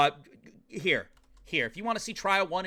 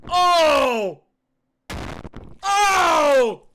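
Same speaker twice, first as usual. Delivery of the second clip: shocked shout; 6 semitones higher, 9 dB louder than usual. Real, not acted. Reacting to execution scene.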